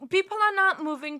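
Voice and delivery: ordinary delivery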